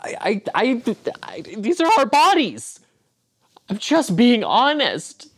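whiny hurt voice. Acted. Husband upset after being yelled at.

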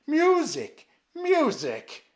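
high, very loud desperate shout. A narrator's reading. Sick emperor calling for music, shouting. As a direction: shout it out to drown the voices. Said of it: "he shouted".

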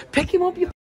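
high, urgent pleading; will the drivers to stop; exasperated. Real, not acted.

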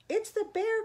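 put-on high, loud child's cry; alarmed cry. A narrator's reading. Eddie warns Mom about the bear, crying out. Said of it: "cried Eddie".

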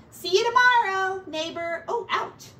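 high, loud friendly rat voice; Ratty saying goodbye to Mouse; cheery pack rat farewell. Acted. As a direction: call it out cheerfully.

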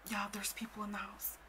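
meek imitated whisper. Friend admitting others are home, whispering. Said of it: "she's, like, whispering".

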